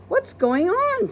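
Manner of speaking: curious maid; puzzled. Acted. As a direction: ask bewildered. Amelia puzzled.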